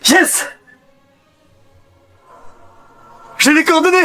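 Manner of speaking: high-pitched jubilant shout-out; elated high squeal; triumphant cry. Real, not acted. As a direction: announce the find in high excitement. Finds the lost coordinates.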